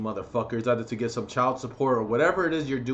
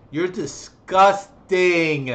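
higher, louder, slow contempt; loud drawn-out disgust; 5 semitones higher, 7 dB louder, much slower than usual. Real, not acted. Ranting at abusive parents, slow loud disgusted call. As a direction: stretch it out and blast it.

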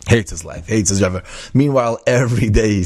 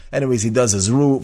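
ordinary talk, nothing marked

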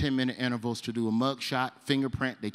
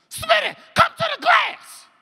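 very high, loud calling voice; hollering; 6 dB louder than usual. Retold or imitated. Inmate summoning his friend to the glass, high yell.

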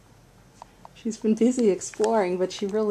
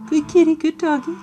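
squeaky praising a dog; 6 semitones higher, faster than usual. Real, not acted. Praising the new pets.

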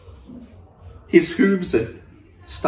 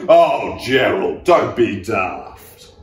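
mocking scoff; 5 dB louder, slower than usual. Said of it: "The animals all laughed".

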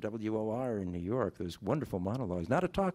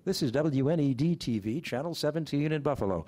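booth announcer's station ID, higher and louder; annonce de speaker; 5 semitones higher, 4 dB louder than usual. Retold or imitated. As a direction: project it like a live TV booth announcer. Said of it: "all the booth announcer would say".

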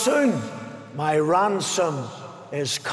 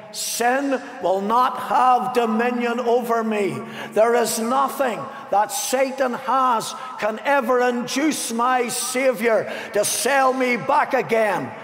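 higher, rising to a shout; fervent preacher shouting victory; preaching climax; 4 semitones higher than usual. Real, not acted. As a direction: build to a triumphant shout. Sermon climbs to its climax.

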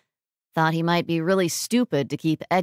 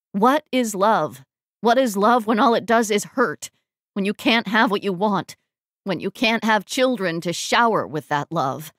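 louder, anguished outburst; bitter anguish; 3 dB louder than usual. Acted. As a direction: hurl the questions out in bitter hurt. Sobbing, bitter outburst after his speech.

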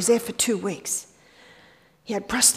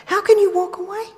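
high, louder protesting question; incredulous objection; 10 semitones higher, 5 dB louder than usual. Acted. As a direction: throw the advice back as an impossible demand. Repeats the listener's objection.

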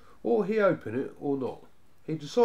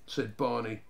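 ordinary delivery

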